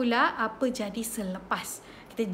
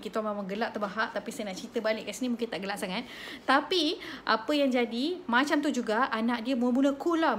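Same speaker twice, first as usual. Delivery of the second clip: ordinary talk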